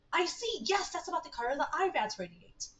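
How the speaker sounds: a hint of an excited investigator; lightly voiced researcher. Voices game character's discovery.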